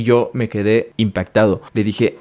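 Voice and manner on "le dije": ordinary delivery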